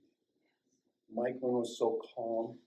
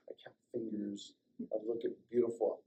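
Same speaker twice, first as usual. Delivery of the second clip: ordinary talk, nothing marked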